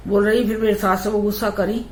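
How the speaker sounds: ordinary talk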